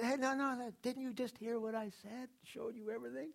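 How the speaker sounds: acting out Cesar Millan scolding the owner who tensed up; exasperated trainer. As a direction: correct them like a trainer who just showed them how. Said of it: "He said".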